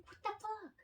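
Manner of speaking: baffled at the warning; mock disbelief; exasperated. Reacting to Facebook warnings.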